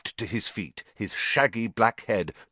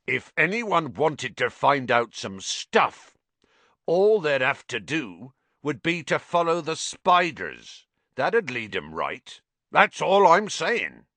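raised, deliberate voice with pauses; careful hinting; 8 semitones higher, 5 dB louder than usual. A narrator's reading. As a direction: drop the hint pointedly, word by word. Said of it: "said carefully".

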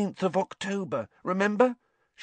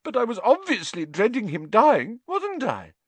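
high, loud wailing protest; distraught, indignant high voice; tearful; 13 semitones higher, 4 dB louder than usual. A narrator's reading. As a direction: insist tearfully. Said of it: "looking up at Hermione with streaming eyes".

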